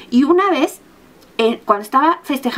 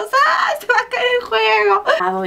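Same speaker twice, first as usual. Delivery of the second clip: very high, slow panicked wail; hammed-up panic with a friend on the ride; mock terror; 10 semitones higher, slower than usual. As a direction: wail that the ride will fall. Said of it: "Y vamos".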